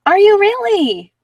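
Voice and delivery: high, loud and drawn-out surprise; genuinely thrilled at a viewer's news; delighted surprise. Real, not acted. Reader reacts to Kimberly's news with real surprise.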